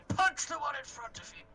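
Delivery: high, loud order to attack; called-out command. Acted. Player orders a summoned frog to attack, called-out command. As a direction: call it out sharply to your summoned fighter. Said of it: "I'll say".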